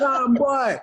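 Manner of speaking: high, amused Jamaican exclamation; Jamaican accent. Pretend. Wrestler capping his bit for the co-hosts, in a Jamaican accent. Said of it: "drop the accent".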